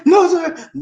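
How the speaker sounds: high mock child voice; tiny scared no sir; scared boy. Boy answers inmate.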